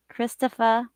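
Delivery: put-on Sopranos-style northern accent, dropped R. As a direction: say Christopher like The Sopranos, ending in "-fuh". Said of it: "I have to say it like that".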